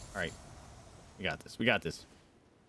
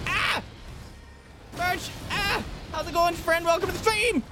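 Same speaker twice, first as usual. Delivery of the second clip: very high yelped words; high-pitched frantic squeal; panicked shrieking. Real, not acted. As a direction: greet a viewer while screaming under attack. Attacked while greeting new viewer.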